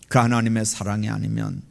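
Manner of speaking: ordinary talk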